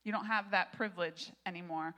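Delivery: ordinary talk, nothing marked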